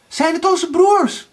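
high, loud, eager question; hopeful calling. A narrator's reading. Erika asks Anna about their brothers, calling eagerly. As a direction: call up with hope.

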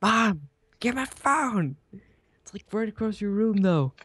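high-pitched bratty yell for mom; whiny kid yelling. Pretend. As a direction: shout for mom like a spoiled kid. Gamer yells for mom like kid.

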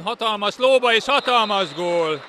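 loud, high goal call; excited sports commentary. Real, not acted. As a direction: shout the goal with joy. Goal called in live commentary. (izgatott gólkiáltás)

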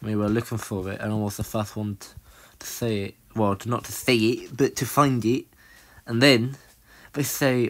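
normal talking voice

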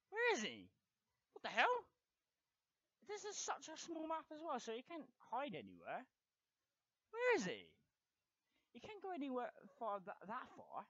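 loud, high-pitched exasperation; agitated disbelief. Real, not acted. Gamer can't find opponent on small map, loud and high. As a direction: shout it high in baffled frustration.

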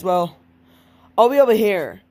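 ordinary talk

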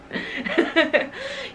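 high soft giggle; little laugh at the joke. Real, not acted.

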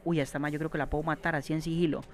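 hushed and quick, plotting a stealth kill; stealthy murmur. Real, not acted. Gamer whispering to himself while sneaking up on an enemy, hushed.

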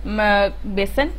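usual speaking voice

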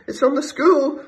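frightened woman shrieking at a bear as she flees. A narrator's reading. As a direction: shriek it while running away. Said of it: "shrieked the woman".

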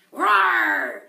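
loud dinosaur roar; loud, acted roar for children; playful dinosaur roar. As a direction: roar big like a storybook dinosaur. Reading dinosaur book aloud.

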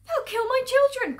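high, loud wail of alarm; panicked cry. Acted. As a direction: cry out as a terrified mother. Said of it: "cried Missus Fox".

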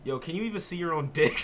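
normal talking voice

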